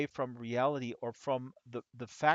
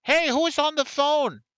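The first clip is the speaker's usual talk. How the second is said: much higher, louder, slower woman's voice; imitated woman shouting from far off; woman in background; 12 semitones higher, 11 dB louder than usual. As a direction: call out from the next room as a suspicious woman. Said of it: "you hear in the background a woman".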